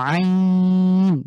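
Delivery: আইন squeezed from mid-throat, long and drawn out; long Arabic ain from the throat; throaty ain. Shown as a demonstration. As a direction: press the throat and hold আইন. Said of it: "ঠিক হার মতন করে উচ্চারণ করব".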